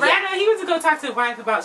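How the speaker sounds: ordinary talk, nothing marked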